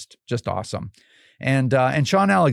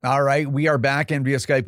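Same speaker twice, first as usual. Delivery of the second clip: usual speaking voice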